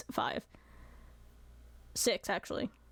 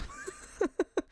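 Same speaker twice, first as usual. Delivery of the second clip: 1 high laugh; 12 semitones higher than usual. Real, not acted.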